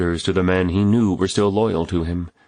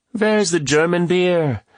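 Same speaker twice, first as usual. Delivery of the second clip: high, slow demanding question; interjecting; 12 semitones higher, 3 dB louder, slower than usual. A narrator's reading. German guest breaks in with a request, high-pitched. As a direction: lift the pitch high and stretch it out. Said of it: "Anna interjected".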